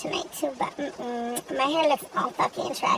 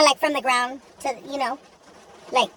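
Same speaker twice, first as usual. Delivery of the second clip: ordinary delivery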